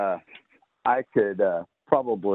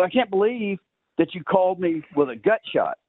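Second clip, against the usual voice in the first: indignant complaint; 12 semitones higher than usual. Retold or imitated. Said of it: "He goes".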